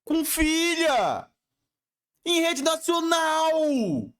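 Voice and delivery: high-pitched, very drawn-out cries; outraged exclamation. Real, not acted. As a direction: wail each phrase slowly on one high held note. Outraged reaction to the news. (exclamação indignada, aguda e arrastada)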